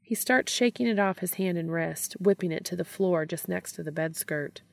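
usual speaking voice

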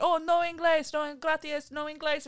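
high mock-Spanish voice; fake Spanish accent. Pretending to be Spanish to dodge a pamphlet. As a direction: play dumb in a put-on Spanish accent. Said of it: "Let's pretend we're Spanish".

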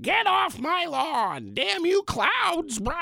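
put-on high, drawn-out old-crank yell; hammed-up grouch, stretched out and high-pitched; get-off-my-lawn guy. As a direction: rail at the clouds like a cranky neighbor. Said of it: "I sound like that guy".

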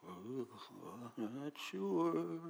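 soft, slow hesitant doubt; mocking weak faith, softly; doubtful. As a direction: hedge it weakly. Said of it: "their faith in him is like this".

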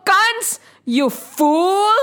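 high, drawn-out mockery; theatrical scornful taunt; taunting goddess. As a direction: jeer at the foolish villain. Goddess taunts the evil king.

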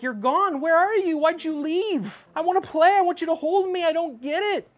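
put-on child asking where dad went, high and plaintive; imitating little Nathaniel. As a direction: beg like a toddler who misses daddy. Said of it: "he's just like".

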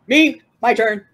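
high, loud eager outburst; eager claim. Acted. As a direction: jump in loudly to grab the turn. Eager character claims the crane.

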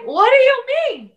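high, loud confused mother calling from the couch; confused mom. Retold or imitated. Mom overhearing from the couch, high baffled shout.

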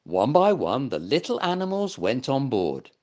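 usual speaking voice

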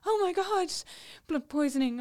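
high, loud panicked exclamation; panicked cry. Retold or imitated. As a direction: blurt it out in sudden alarm, pitched high. Retelling panic at sepsis news.

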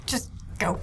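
whispered plea to leave; under-the-breath mutter. Retold or imitated. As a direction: breathe it out, barely voiced. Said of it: "I'm at the same time just going".

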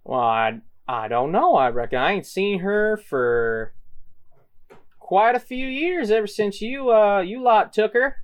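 folksy bar woman, pitch climbing high; Sally. Acted. Game master voices Sally.

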